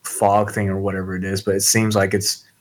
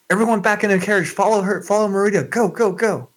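urgent shout; 10 semitones higher, faster than usual. Acted. Shouting orders to flee.

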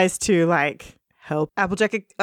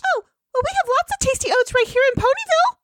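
high pony voice; faster than usual. Acted. Voicing Pinkie Pie.

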